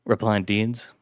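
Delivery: ordinary delivery, nothing marked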